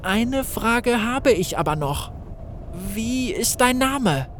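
put-on high, slow, hesitant young voice; hesitant Gareth. Gareth asks the sand being its name, hesitant. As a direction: ask warily, unsure. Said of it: "fragte Gareth zögerlich".

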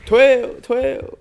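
alarmed call. Real, not acted. Sees girlfriend arriving, alarm call.

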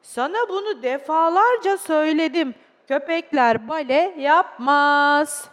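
loud, emphatic telling-off, drawn out at the end; scolding father. Acted. Father telling the child off about the dog, stern. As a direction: lay down the law loudly.

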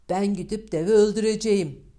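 loud, determined vow; bold declaration. Acted. Eldest son loudly vowing to his father to kill the giant. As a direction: announce it boldly to your father.

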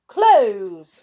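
loud, firm one-word order; magic command. A narrator's reading. Little Miss Magic orders her door shut, commanding.

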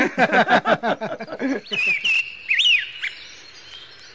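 long loud hearty laugh. Acted. Chico laughs with his brothers, hearty laugh.

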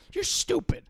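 high, nasal mom voice; mom impression. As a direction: play the mom scolding her son. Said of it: "my mom sounds like Marge Simpson in my impression".

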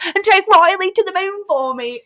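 high, loud mimicked British accent. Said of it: "but she has an accent".

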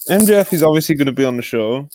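ordinary talk

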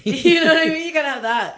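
loud high laugh-through; teasing with a big laugh. Real, not acted. Laughing teasing about accent.

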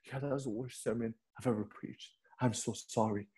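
very soft, meek apology; hushed retold apology to a mentor; acted humility. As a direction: imitate a humble confession, barely voiced. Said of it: "being really humble by saying".

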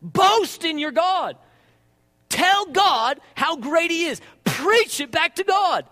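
loud, high, slow preaching; forceful exhortation. Real, not acted. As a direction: punch each command out slowly and loudly. Preacher urging congregation at force.

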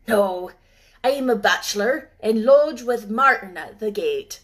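put-on old working man teasing the girl; lightly voiced old gardener. Gardener Ben teasing Mary, gruff old Yorkshireman.

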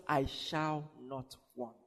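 soft, slow, low; quiet conviction. Acted. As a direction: settle it calmly, almost to yourself. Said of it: "with that conviction".

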